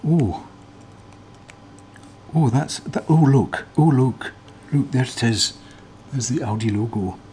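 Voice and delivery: imitated Scottish accent, eager and rising; Big Clive accent. YouTuber imitating a fellow teardown YouTuber in a Scottish accent. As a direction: put on a Scottish accent, getting excited over the find. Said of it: "I'll stop doing the accent now".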